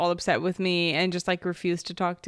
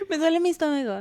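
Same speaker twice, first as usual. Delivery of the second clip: lady's excuse; 8 semitones higher, faster than usual. Pretend. Playing the caught lady's excuse.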